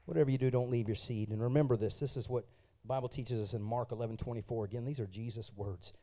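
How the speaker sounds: normal talking voice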